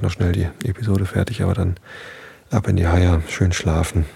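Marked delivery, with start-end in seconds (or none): none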